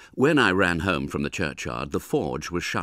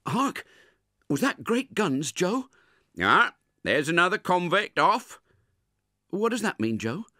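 put-on higher curious child voice; lightly voiced boy Pip; 12 semitones higher, 3 dB louder than usual. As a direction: ask about the noise in wonder. Pip asks Joe about guns.

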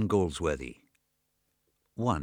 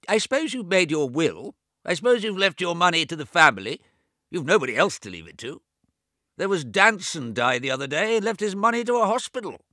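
put-on high, loud old man talking over his own deafness; deaf old James; 11 semitones higher, 7 dB louder, faster than usual. A narrator's reading. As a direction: raise the voice as if he can't hear himself. James prods about the will.

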